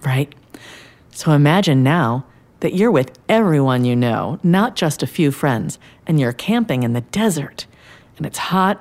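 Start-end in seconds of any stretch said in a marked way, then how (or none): none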